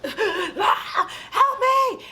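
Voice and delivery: very high-pitched cry for help; hammed-up horror victim's shriek; mock terrified scream. Acts the fleeing girl screaming.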